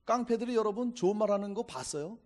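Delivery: normal talking voice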